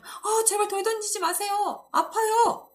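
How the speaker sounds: high, loud mock pleading for life; acted panicked cry. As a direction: beg them to stop, hammed-up terror. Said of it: "이렇게 외칠지도 몰라요".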